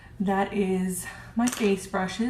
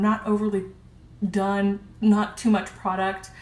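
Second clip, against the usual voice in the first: ordinary talk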